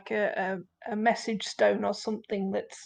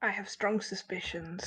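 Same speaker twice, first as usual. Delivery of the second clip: whispering; 2 semitones lower, 7 dB quieter than usual. Acted. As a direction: whisper it like a secret. Whispered in-character aside.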